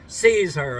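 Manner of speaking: mock TSA boss calling out an order; acted command. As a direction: order the agents to grab her. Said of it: "pointing her out and saying".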